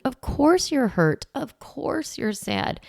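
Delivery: soothing validation. Shown as a demonstration. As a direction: reassure the hurt child gently. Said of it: "we're validating their emotion".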